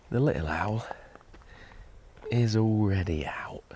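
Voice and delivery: hushed whisper. Real, not acted. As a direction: whisper so the owl stays asleep. Close to a sleeping owl.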